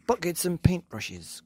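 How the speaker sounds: lightly urgent storybook cry. A narrator's reading. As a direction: call out the order eagerly. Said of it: "cried Mugglewump".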